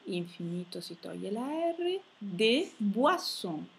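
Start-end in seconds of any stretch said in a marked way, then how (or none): 2.2-3.6 "des boissons" slow, "boi" said bwa. Shown as a demonstration. Teacher models the diphthong, slow with OI as "wa". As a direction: stretch "boissons" as bwa-sson. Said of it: "O I si legge ua".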